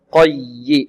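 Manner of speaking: slow "قي" leaning hard into the doubled y; stressed doubled yaa. Shown as a demonstration. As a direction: press and hold the y of "قي". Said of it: "والياء هنا مشددة، نبر".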